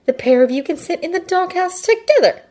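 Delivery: mild snarl climbing high at the end. A narrator's reading. Girl banishing two classmates to the doghouse, irritated snarl. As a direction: dismiss them with rising irritation. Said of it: "Jiro snarls".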